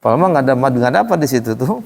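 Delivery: normal talking voice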